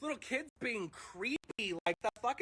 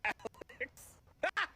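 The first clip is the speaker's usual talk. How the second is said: ordinary delivery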